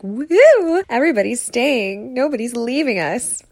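a happy pup cheering that everyone is home; excited dog. Retold or imitated. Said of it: "To her, this is just like".

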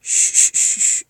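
urgent shushing. Acted. Hushing the upset boy.

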